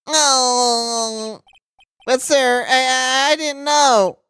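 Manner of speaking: flustered nerd voice, pitch up. Acted. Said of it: "the nerd voice".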